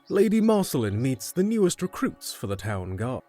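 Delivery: normal talking voice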